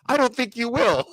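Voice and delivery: very high, laughing through the line; laughing mock reply. Retelling the goodbye exchange, a laughing mock reply. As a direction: deliver the burn while cracking up. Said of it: "And he's like".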